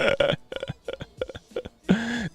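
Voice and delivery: high laughing fit; real helpless laughter. Real, not acted. Streamer failing to read the news, helpless high laughter. Cannot finish reading for laughing.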